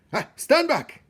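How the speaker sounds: high loud commanding rat voice; amused rat boss. A narrator's reading. Botticelli orders the jostling rats back. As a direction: wave them back with glee. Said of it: "still laughing".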